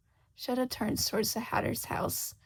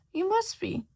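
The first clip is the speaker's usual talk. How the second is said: higher, louder sly cat voice; Cheshire Cat; 10 semitones higher, 4 dB louder than usual. Acted. Cheshire Cat answering lost Shota, sly and amused. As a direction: purr it like a teasing cat.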